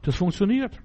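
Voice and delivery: soft, astonished realisation; surprised. Shown as a demonstration. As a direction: let the amazement lift it up. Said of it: "Ich bin überrascht".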